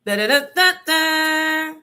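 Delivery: loud high da da da babble; mocking babble. Mom mocking the nun's lecture, high loud babble. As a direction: mimic a nagging lecture.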